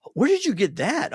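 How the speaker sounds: retelling a stranger's startled question; surprised. Retold or imitated. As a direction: ask it like you can't believe your eyes. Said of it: "He goes".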